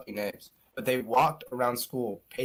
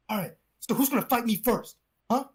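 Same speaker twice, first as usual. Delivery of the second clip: mock tough-guy challenge, pitched up; retold bully sizing up victims; imitated fight challenge; 6 semitones higher than usual. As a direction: act out picking a fight.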